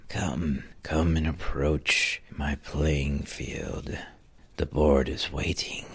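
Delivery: slow dark creature voice; eerie entity. Acted. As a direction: lure them in slowly like a patient ghoul.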